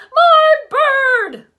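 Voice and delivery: put-on high, loud little-boy call. A narrator's reading. Fudge calling from under the table, high shout. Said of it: "Fudge called".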